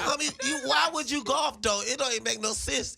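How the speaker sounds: high-pitched comic disbelief; mock exasperation. Comic asks homie why he would golf, mock exasperated. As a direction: question him in a high, incredulous protest. Acted scolding of a golfer.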